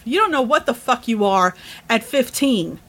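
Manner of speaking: ordinary talk, nothing marked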